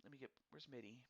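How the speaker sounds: ordinary talk, nothing marked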